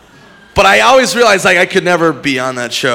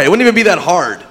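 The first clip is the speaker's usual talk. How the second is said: usual speaking voice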